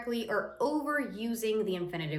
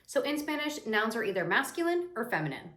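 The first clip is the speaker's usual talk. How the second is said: ordinary delivery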